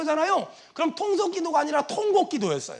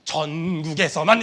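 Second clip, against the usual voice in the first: 전국에서만 slow, low and louder; louder, less dragged hymn delivery of 전국에서만; hymn line demo; 12 semitones lower, 5 dB louder, slower than usual. Shown as a demonstration. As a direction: push out 전국에서만 low, stretching the first syllable. Second take of the hymn line.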